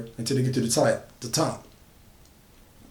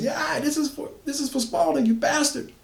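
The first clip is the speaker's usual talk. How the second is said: much higher, furious cry of revenge; woman avenging her dead friend; vengeful scream; 13 semitones higher than usual. A narrator's reading. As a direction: scream it as you drive the pitchfork in. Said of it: "she screams".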